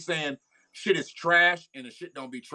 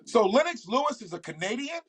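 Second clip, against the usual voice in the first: higher-pitched, puzzled question; surprised; 7 semitones higher than usual. Real, not acted. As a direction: check it in genuine surprise. Learns a boxer is Canadian.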